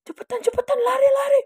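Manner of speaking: high-pitched mock scream, held back in volume; imitated panicked yell. Friend screaming at the rider to speed off, high mock yell. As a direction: urge them to flee in a shrill retold shout. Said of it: "teriak nih temen aku ya kan".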